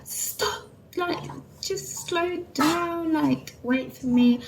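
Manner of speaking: high, slow, strained calling out; tearful plea. Retold or imitated. Trailing behind boyfriend with the buggy, high and slow plea. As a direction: beg him to wait, near tears. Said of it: "and I'm crying".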